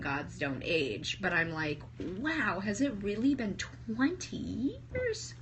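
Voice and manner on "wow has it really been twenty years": high, slow, incredulous question; acted disbelief. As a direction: stretch it upward in amazed disbelief. Acted disbelief at time passed.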